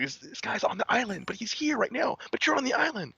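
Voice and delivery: high, thrilled telling others; excited fan. Retold or imitated. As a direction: point him out to everyone, squealing. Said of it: "she's bringing people like".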